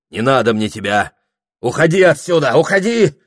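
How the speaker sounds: building to a high, loud shout; angry rejection. A narrator's reading. Прохор sending the witch away, angry shout.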